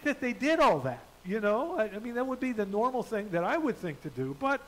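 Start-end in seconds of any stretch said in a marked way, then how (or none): none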